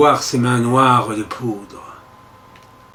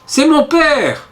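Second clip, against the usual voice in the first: put-on high, slow little child crying out; a six-year-old pleading; tiny voice, much higher, drawn out; small child's voice; 13 semitones higher, slower than usual. A narrator's reading. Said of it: "une petite voix".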